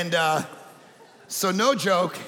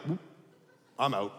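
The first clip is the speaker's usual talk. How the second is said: soft, low mock bailing out; quiet retreat; 11 semitones lower, 8 dB quieter than usual. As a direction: drop low and slip away. Fleeing the skunk.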